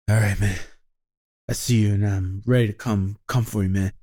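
low, spent tough-guy line, lightly voiced; a hint of a winded Bruce Willis; winded action hero. Describing the classic winded action-hero voice, mild imitation. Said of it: "the classic Bruce Willis thing".

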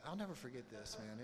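usual speaking voice

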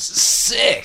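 high, drawn-out "Sick"; a squeaky sneer of "Sick"; mock pigeon lady. Pigeon lady's one word.

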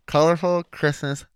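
talking with a mouthguard in; words blurred by a mouthguard. Real, not acted.

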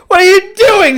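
high, loud mocking disbelief; incredulous shout. Kids catching a fifth grader peeing pants-down, loud disbelief. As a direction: call out in mock shock.

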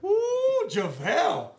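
high, excited whoop; whooping in high-pitched excitement; fired-up reaction. Retold or imitated. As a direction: re-enact a thrilled fan's squealed "ooh". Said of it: "I was fired up".

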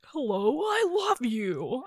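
slow, warped toy voice as batteries die; dying toy voice. Retold or imitated. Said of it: "they would start sounding like".

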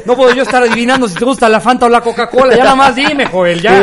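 put-on exasperated rich old boss hurrying his chauffeur; impatient boss. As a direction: push him to just order.

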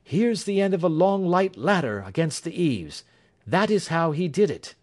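high, excited detective voice; Holmes, triumphant. Acted. Holmes explains his discovery.

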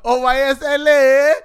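high, loud, stretched-out holler; playful drawn-out hype yell; hyped show shout. Pretend. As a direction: shout it long like a hype man.

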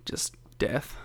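unvoiced deadpan whisper. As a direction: whisper the grim punchline.

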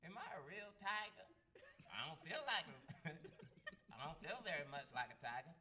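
hammed-up stand-up voice, high and drawn-out; Cat Williams. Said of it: "I can do Cat Williams".

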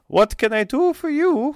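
high, put-on voice of a haughty lady; noblewoman voice. GM voices Olga.

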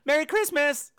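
high, bright Merry Christmas; chirpy holiday greeting while wandering by; cheery greeting. Retold or imitated. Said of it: "is wandering by going".

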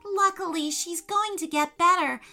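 put-on high, bright child voice full of thanks; a small girl thanking her tiny rescuer; grateful little girl. Girl thanking Firefly for saving her kitty, high and grateful.

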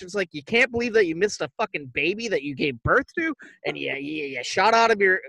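usual speaking voice

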